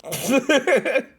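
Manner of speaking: sudden high-pitched laugh; high burst of laughter. Real, not acted. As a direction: crack up at your own joke. Laughing at his own booba joke.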